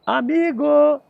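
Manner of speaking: grito agudo e forte de criança. Acted. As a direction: chame o amigo bem alto pela floresta. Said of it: "grita, né?". (menino grita bem alto para a floresta, com voz aguda)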